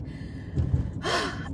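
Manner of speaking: long, soft, defeated exhale; long sigh. Real, not acted. Storyteller done with online dating, long weary sigh. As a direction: let the air out like you're giving up.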